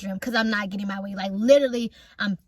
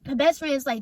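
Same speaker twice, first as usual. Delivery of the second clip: usual speaking voice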